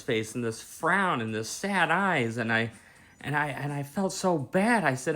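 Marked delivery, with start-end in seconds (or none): none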